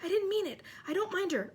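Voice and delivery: high, flustered backtracking; urgent, high-pitched excuse; frantic. A narrator's reading. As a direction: take it back in a panic. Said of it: "Hermione whispered frantically".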